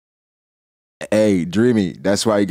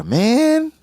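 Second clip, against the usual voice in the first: high whiny Thatch. Retold or imitated. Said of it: "He greeted Blackbeard like".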